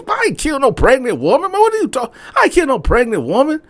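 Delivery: very high, loud, protesting; man denying he killed a pregnant woman, high and loud; outraged denial. Retold or imitated. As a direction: deny it hotly.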